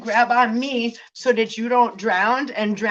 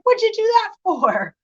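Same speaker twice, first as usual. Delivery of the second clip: high, louder offended whine; mock whiny guy; 11 semitones higher, 5 dB louder than usual. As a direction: sound wounded and silly. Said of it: "And he's like".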